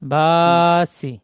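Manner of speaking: বাসি drawn out slowly and loud; slow model. Shown as a demonstration. Teacher models বাসি slowly so the pupil repeats it. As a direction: say বাসি long and slow, then stop. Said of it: "বলেন।".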